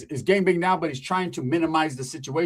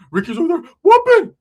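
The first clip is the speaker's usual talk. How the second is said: gasping, high and loud; gasping for breath; 11 semitones higher, 9 dB louder than usual. Retold or imitated. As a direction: heave the words out of breath. Acting out gasping Ricky.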